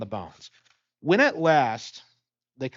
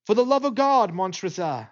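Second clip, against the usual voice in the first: higher, louder cry on "God", falling off on "Montresor"; desperate plea; 5 semitones higher, 3 dB louder than usual. Acted. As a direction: beg for your life. Chained man's desperate final plea.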